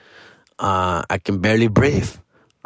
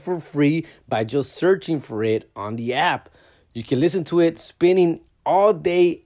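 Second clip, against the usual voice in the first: ad read; 5 semitones higher than usual. Acted. Reads a promo ad.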